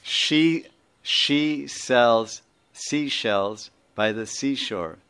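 very slow, each word laid out; slow tongue twister. Shown as a demonstration. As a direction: demonstrate the twister word by word for learners. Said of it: "You say".

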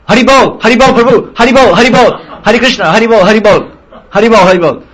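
high, loud, rapid-fire greeting calls; hammed-up cloth seller luring a customer; money-seeking shopkeeper. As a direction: hawk it eagerly at a rich tourist. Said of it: "that Hari Bol is called Paisa Hari Bol".

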